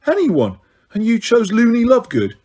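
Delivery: put-on a disbelieving boy, voice rising; can't believe his friend's choice; disbelief. A narrator's reading. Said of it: "said Ron in disbelief".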